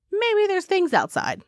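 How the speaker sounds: high, slow, lightly acted wondering; tentatively curious. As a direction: wonder aloud, a touch uncertain. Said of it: "started to get more curious".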